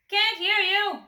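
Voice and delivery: loud, high bellow. A narrator's reading. Greengrocer bellowing at the timid customer. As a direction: boom it out. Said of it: "bellowed the greengrocer".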